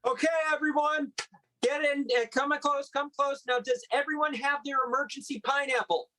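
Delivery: a hint of Trump rallying the crowd; Trump impression. Comedian addresses the crowd as Trump, lightly voiced. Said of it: "Were you doing a Donald Trump imitation?".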